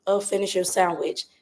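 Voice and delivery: normal talking voice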